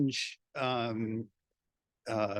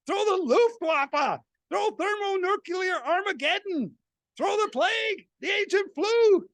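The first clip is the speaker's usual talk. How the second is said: loud, jeering fan in the stands, building to higher shouts; rowdy baseball fan; 13 semitones higher, 3 dB louder than usual. Acted. Fan in the stands calling for the pitch, rowdy yelling.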